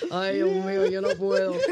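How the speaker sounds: mock mother throwing up her hands; exasperated mother. Mother exasperated by her daughter's singing, mock despair. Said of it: "My mother was like".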